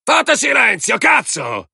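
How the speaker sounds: very high, strained angry yell. A narrator's reading. Man yells at the howling dogs, furious. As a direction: yell for silence, voice pushed right up. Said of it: "Urlò".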